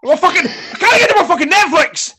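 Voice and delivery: hammed-up fury at tech support; retold furious yell. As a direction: blow up like an angry Scot on the phone. Furious Scot yelling at support.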